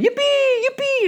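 put-on a kid squealing with joy; gleeful child shouting. Optimist boy squeals joyfully over the manure room. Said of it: "He runs around the room saying".

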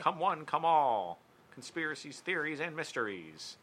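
high, slow ringmaster call; circus announcer. Pretend. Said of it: "reminded me of, like, a circus announcer".

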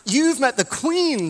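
high, loud, slow amazed gasp of a question; excited question. Retold or imitated. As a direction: tourists thrilled to meet the Queen's bodyguard. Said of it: "they asked him very excitedly".

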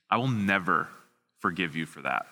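low, quiet, deadly serious imitation; grave child's vow. Offended boy to the man who tickled him, low and grave.

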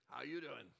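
hint of a worn-out smoker greeting; deep smoker voice. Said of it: "the guy with a deep smoker voice goes".

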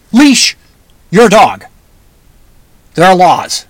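LEASH hit high and loud, alone, then a pause; emphatic command. Pretend. Telling careless owners to leash their dog, emphatic with "leash" punched high.

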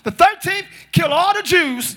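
shouted high and slow; a scheming adviser yelling his plan; villain's demand. Retold or imitated. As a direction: bellow the order to kill. Haman demanding the decree.

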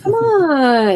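high, drawn-out wheedle imitating him; coaxing. Friend begging her to pay for the pinball machine, coaxing.

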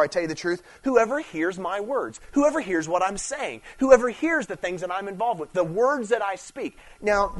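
rising, emphatic preacher voice; preaching. Acted. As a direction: preach it with building force. Preaching to congregation.

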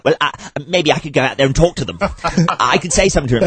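high, fast, lightly voiced fawning politician; Tony Blair impression. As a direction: simper like a sycophant. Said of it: "leaning over going".

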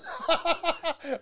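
high-pitched burst of laughter; real laugh. Real, not acted. Cracking up at own story.

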